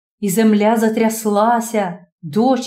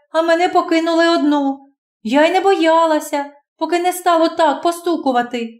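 put-on much higher, rushed, scared young girl; high young girl's voice, shaken by the storm; frightened girl; 6 semitones higher than usual. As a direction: tumble out the words in fright.

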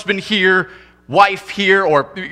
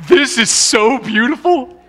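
mock teary gush; 7 semitones higher than usual. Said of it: "tearing up going".

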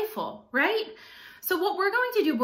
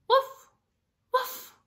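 dog barks; 14 semitones higher than usual. Acted. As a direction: bark it like an excited puppy. Dog barks in picture book.

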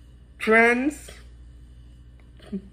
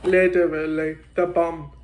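chewing while talking; talking between burger bites; mouth full of burger; 3 semitones lower, faster than usual. Real, not acted. Seen 0: Burger at mouth through the words; he keeps biting and chewing.

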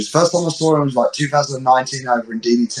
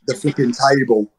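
usual speaking voice

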